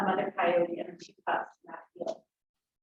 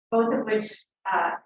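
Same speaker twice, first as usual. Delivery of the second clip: ordinary talk, nothing marked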